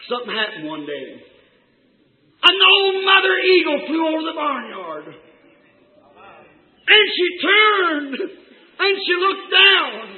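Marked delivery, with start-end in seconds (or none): 2.4-10.1 high, loud, drawn-out storytelling; full-force preaching. Acted. Preacher tells congregation of mother eagle, full-force preaching.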